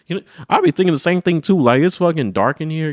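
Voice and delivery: high, loud and fast; excited rant. Real, not acted. Vlogger ranting about dark episode, excited.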